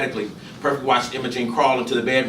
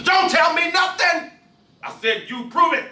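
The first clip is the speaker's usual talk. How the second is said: high, loud order cutting her off; a husband shouting down his wife's excuses; angry shout; 6 dB louder than usual. Acted. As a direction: bark him silent. Gus shouts at wife.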